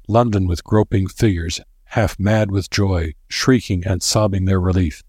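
ordinary delivery, nothing marked

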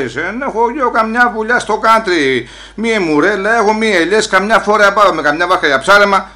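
retold shouting back.

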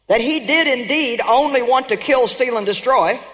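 usual speaking voice

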